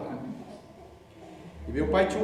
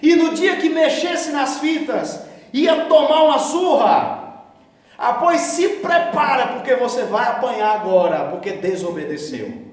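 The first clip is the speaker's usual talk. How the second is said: loud, high furious scolding; angry father scolding; 8 semitones higher, 6 dB louder than usual. Retold or imitated. Father scolding son for touching tapes, loud angry rebuke. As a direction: tear into the disobedient son. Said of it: "meu pai olhou pra mim e falou".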